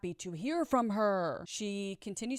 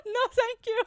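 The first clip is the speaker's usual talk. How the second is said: high, loud squeal through laughter; laughing; 8 dB louder than usual. Real, not acted. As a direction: crack up while refusing. Cracks up at own joke.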